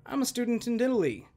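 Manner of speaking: ordinary delivery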